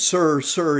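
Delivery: confronting. Retold or imitated. Older man confronts the young father.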